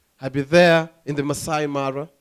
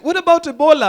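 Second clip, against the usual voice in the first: mock nervous tourist; 9 semitones higher, 7 dB louder, faster than usual. Tourist asks, voiced high.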